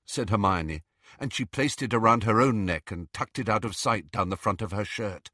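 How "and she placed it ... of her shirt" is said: ordinary delivery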